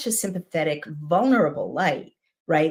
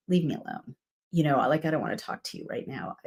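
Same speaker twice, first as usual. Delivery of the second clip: softer, nearly a whisper, wounded brush-off; hurt, half-whispered; 6 dB quieter, faster than usual. Retold or imitated. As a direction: turn away, too hurt to talk. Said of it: "His, his feelings are hurt".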